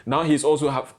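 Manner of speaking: ordinary delivery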